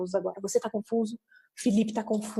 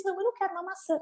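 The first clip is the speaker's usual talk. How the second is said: high, softer acted refusal; literal-minded refusal; 9 semitones higher, 5 dB quieter, much faster than usual. Parent answers the child's supposed question, high innocent refusal. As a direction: decline lightly in a high parent voice. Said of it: "Você entendeu que era uma pergunta".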